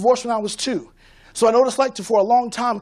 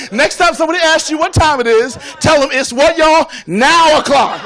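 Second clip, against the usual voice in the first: louder, rousing; 4 semitones higher, 6 dB louder than usual. Shown as a demonstration. Speaker rallying the audience to shout back, rousing and loud. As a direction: pitch raised and louder, pumping up the crowd. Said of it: "Do that for me".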